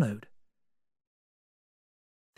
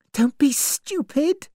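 put-on higher, anxious young woman; young woman's voice; 13 semitones higher than usual. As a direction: voice Vera as uneasy and searching. Vera scolds Lombard.

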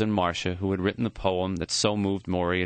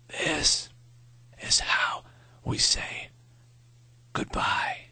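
faint breathy whisper of a dying man; weak whisper; much slower than usual. Acted. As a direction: whisper it with the last of your breath. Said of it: "His voice was thin and raspy".

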